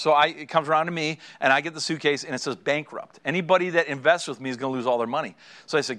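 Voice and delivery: usual speaking voice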